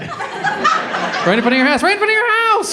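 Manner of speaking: ramp up the disbelief until you're shouting it; building to a laughing shout. Real, not acted. Comic driving it home to the crowd, building to a shout. Building punchline, rising pitch.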